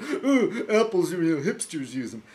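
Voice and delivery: high, mocking whine with a laugh in it; high-pitched mock disdain; mocking imitation. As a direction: mimic a silly complainer. Mocks the hater's complaint.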